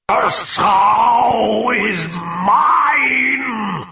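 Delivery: very slow, high, roaring demon cry; slow coarse demonic bellow. Acted. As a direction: bellow it with monstrous power. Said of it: "she bellowed in a coarse and powerful voice".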